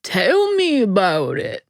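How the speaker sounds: put-on old man's remark in a Southern accent. A narrator's reading. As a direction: answer as an old Southern man. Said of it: "the old man says".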